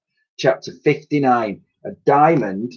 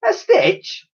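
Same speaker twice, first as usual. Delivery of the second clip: much higher, astonished question; surprised. A narrator's reading. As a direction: echo it in disbelief. Dotty's surprised echo.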